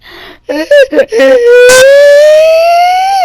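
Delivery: high loud fake crying wail; mock crybaby wail. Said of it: "he's like".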